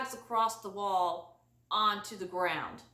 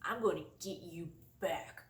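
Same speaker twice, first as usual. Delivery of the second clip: muttered threat; 5 semitones lower, 10 dB quieter than usual. Retold or imitated. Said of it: "I'm like".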